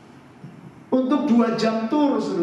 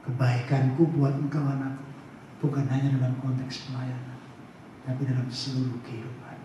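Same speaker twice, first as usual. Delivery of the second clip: low, tender, reassuring voice; God; 7 semitones lower, 7 dB quieter than usual. Retold or imitated. God answering his child about holiday blessings, softly reassuring. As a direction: speak softly and gently, with unhurried pauses between phrases. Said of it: "Dan Tuhan bilang begini".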